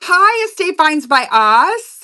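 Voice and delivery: high, loud, slow drawn-out hello; excited greeting. Real, not acted. Host welcomes a shopper to the live, excited. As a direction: call out a big, drawn-out welcome.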